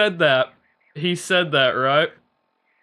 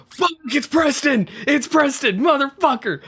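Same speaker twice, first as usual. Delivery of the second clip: high, thrilled retold realization; excited recognition; 11 semitones higher than usual. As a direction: yelp it as the penny drops, much higher. Recognizing the voice in-game.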